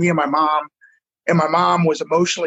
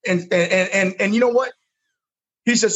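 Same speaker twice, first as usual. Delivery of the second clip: usual speaking voice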